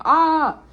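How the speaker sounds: sudden high, loud "ah"; cry of distress. Real, not acted. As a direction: let out a high cry, fighting tears. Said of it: "I don't wanna cry".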